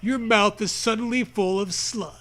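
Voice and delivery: ordinary talk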